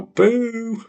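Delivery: high, loud playful boo; mock boo. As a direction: jeer at chat. Booing chat.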